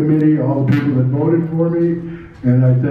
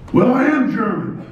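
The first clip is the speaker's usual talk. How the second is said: much higher retold outburst; plucked-up courage; 9 semitones higher than usual. Rookie finally answering the champion, high plucked-up courage. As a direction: blurt it out high, finally daring. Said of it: "I got the courage to say".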